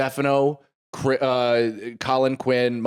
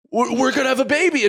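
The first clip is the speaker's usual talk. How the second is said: higher, fast, giddy announcement; excited rush; 12 semitones higher, 3 dB louder, faster than usual. Retold or imitated. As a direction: blurt the news out in joy. Rushed joyful announcement.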